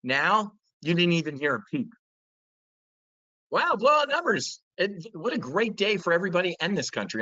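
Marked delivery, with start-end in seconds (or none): 3.5-7.2 sarcastic gushing, voice jumping much higher; mock excitement. Mocking the media cheering the jobs report, in sarcastic gushing. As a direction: fake cheer, overplaying how great the numbers are.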